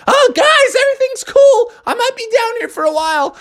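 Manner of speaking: very high, loud squeak; excited frog. Acted. Froggy tells his friends everything's cool, excited. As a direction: call back gleefully.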